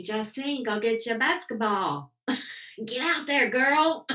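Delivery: a light mock pep talk, rising high and loud on girl; playful cheer. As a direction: cheer her on like a coach, half joking.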